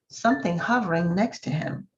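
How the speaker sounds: ordinary delivery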